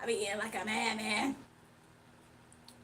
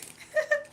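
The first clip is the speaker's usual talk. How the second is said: high squeal laugh; 5 dB louder than usual. Real, not acted. Laughs after cookie.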